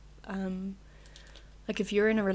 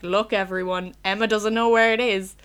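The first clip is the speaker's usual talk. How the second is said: mocking teacher; 10 dB louder than usual. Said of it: "went to a map and was like".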